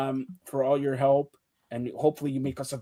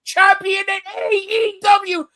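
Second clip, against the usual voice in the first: excited announcement; 13 semitones higher, 6 dB louder, slower than usual. Real, not acted. Announcing friend's title win.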